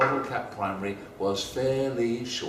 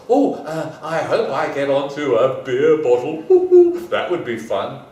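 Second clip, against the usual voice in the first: louder, bright eager voice with a laugh; eager bottle cap; 10 dB louder than usual. Acted. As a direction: sound thrilled at the idea. Hopeful little bottle cap.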